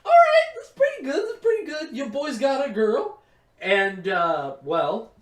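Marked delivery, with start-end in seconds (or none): 0.1-3.2 put-on crowing that he finally got a girl; excited boast. Teen boy bragging to himself, high and hyped. Teen self celebrating new girlfriend.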